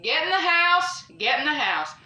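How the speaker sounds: frightened order to get inside; urgent command. Retold or imitated. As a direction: shout it urgently, then settle. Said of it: "and I was like".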